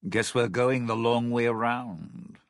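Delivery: ordinary talk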